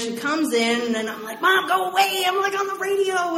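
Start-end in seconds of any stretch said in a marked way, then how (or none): 1.4-3.4 put-on high, loud teen yell; shouting at mom. Teen on the radio yelling at mom to leave. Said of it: "screaming in my bedroom".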